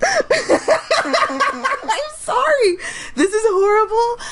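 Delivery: high, squeaky laughing apology; laughing so hard she can barely talk; real laughing fit, squeaking out an apology; helpless laughter. Real, not acted. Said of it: "who laughs throughout their whole video".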